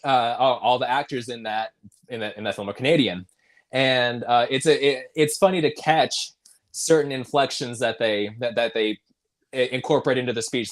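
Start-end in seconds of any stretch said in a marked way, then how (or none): none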